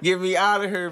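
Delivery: laughing through the words; half-laughing plea to get out; giggling get me out of here. Real, not acted.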